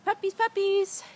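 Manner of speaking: high loud sing-song call. Real, not acted. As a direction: call the puppies in a bright coo. Calling puppies.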